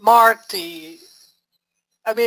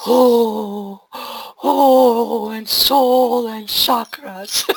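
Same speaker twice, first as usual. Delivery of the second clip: syrupy nice voice; 2 semitones higher, 4 dB louder, much slower than usual. Shown as a demonstration. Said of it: "I can't even mi-, imitate it".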